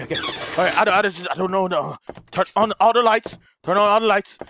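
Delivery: ordinary delivery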